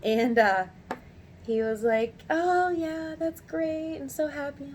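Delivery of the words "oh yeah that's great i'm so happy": high-pitched pleased reply; imitated delight. Supervisor hearing praise of his worker, delighted.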